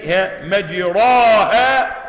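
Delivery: مجراها recited slowly and loud, with the imala; Quranic recitation. Shown as a demonstration. Reciter quoting the verse to students, slow and loud. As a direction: recite مجراها stretched out, vowel leaning to e. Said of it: "هو بيقرأها إزاي".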